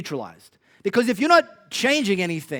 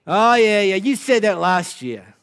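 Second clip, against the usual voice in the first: put-on Aussie accent; 2 semitones higher, 8 dB louder, slower than usual. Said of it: "I have to do it in Aussie accent".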